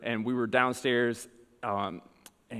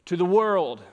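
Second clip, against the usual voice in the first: higher, louder sermon voice; emphatic preaching; 8 semitones higher, 5 dB louder than usual. Real, not acted. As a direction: preach it out with force. Preaches with rising force.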